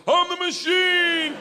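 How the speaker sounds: high, slow, shouted battle cry; catchphrase yell. Retold or imitated. Frat boy yelling the one line he knew to gangsters. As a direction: bellow it out proudly. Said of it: "all I knew how to say".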